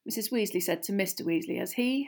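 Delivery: usual speaking voice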